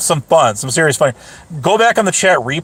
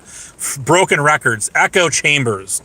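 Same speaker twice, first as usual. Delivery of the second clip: ordinary talk, nothing marked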